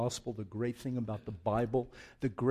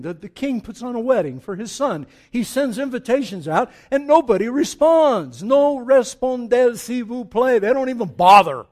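high, loud preacher's storytelling; loud, heated pulpit retelling; full-force preaching; 9 semitones higher, 13 dB louder than usual. Real, not acted. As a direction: build the parable to an outraged peak.

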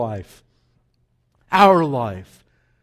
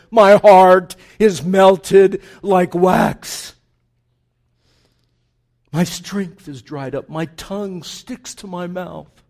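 dramatic psalm reading; 9 dB louder than usual. Acted. Preacher reading David's lament to the congregation, building. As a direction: lament read aloud, rising in force.

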